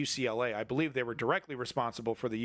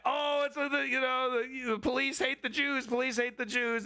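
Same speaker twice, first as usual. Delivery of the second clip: mocking whiny voice; 10 semitones higher than usual. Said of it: "mocking the man's claim".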